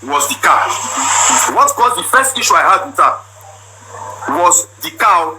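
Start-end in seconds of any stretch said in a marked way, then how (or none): none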